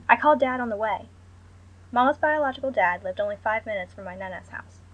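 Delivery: ordinary delivery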